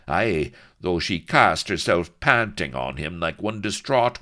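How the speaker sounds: loud, oily sly voice; unctuous. Acted. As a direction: lay it on thick and greasy. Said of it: "said Blood unctuously".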